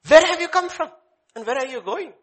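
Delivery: high, loud questioning angel; angel asking. Retold or imitated. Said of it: "He said".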